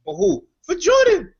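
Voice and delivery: voce alzata di molto, esaltata per Jordan; high excited shout. Pretend. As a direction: squeal it out like a starstruck fan. Joking about Jordan.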